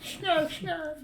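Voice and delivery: high squeaky cartoon cat; Snarf. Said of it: "I gotta talk like Snarf".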